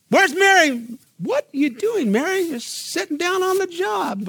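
high, indignant questioning; high indignant calls, slowing at the end; Martha's complaint. Retold or imitated. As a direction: sound put out at the idle sister. Martha complaining about Mary.

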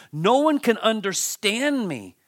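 high, loud cry of isolation; Job's anguish. Acted. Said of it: "Do you hear his emotions here?".

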